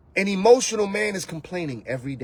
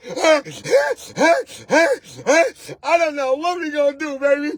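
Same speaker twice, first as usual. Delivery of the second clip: mock crying; 6 semitones higher than usual.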